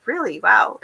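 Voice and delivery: reenacted mild amazement, high on Really; retold surprise. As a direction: act out being taken aback. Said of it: "I'll be like".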